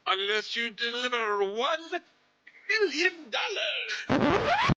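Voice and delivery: squeaky, stretched supervillain demand; Dr. Evil. Pretend. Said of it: "You had to Austin Powers it".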